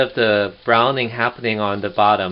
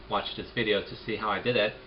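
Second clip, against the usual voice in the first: ordinary delivery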